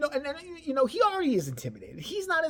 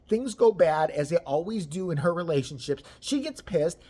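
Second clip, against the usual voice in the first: ordinary talk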